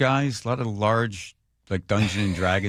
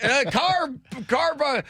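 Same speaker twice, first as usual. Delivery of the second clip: high, stumbling Southern caricature; Southern accent; slower than usual. Retold or imitated. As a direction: stammer it out in a put-on Southern voice. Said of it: "You can do a Southern accent".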